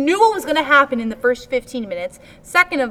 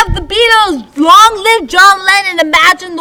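mock tribute; 9 semitones higher, 11 dB louder than usual.

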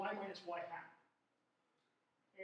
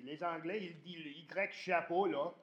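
mock French speaker; 9 dB louder than usual. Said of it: "They probably say".

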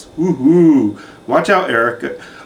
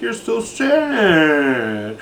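mock sad; 3 semitones higher, much slower than usual. Mocking houseguest's sad mood.

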